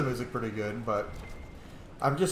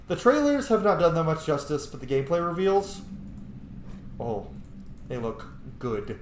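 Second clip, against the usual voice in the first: usual speaking voice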